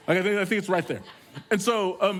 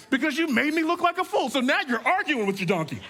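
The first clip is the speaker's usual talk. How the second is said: indignant Balaam; 10 semitones higher than usual. Acted. Balaam angry at donkey.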